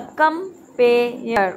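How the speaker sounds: slow compare with gaps between the parts; split into syllables. Shown as a demonstration. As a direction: break compare apart, beat by beat. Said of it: "कम एक हो गया और pair दूसरा". (Compare को धीरे-धीरे टुकड़ों में तोड़कर)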